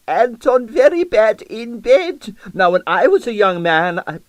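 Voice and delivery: put-on loud boastful old dancer; Russian accent. A narrator's reading.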